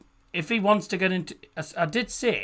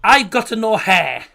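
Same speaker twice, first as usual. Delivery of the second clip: Pep Guardiola impression; 5 semitones higher, 7 dB louder, slower than usual. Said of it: "I can do Pep Guardiola".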